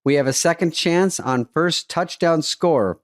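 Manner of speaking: slow, upbeat sponsor read; host reading a Bet MGM ad, slow and upbeat. Acted. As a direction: sell it like a sportsbook ad.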